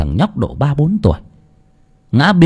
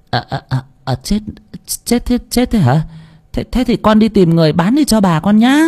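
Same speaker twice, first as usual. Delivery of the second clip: flustered; 10 semitones higher than usual. Acted. Bà Phú bị bắt quả tang.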